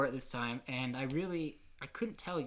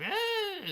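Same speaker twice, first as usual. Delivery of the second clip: high mock wail; 4 dB louder than usual. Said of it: "be like".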